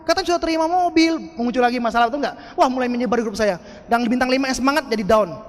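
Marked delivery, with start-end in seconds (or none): 0.0-1.2 high, drawn-out demand for proof; mock challenge. Doubters demand proof of the car, high mocking challenge. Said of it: "Orang bilang".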